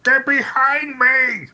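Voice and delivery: loud, high-pitched drawn-out shout; high, slow yell in character. Acted. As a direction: shout a warning to a teammate, stretched out. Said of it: "to shout at Hector".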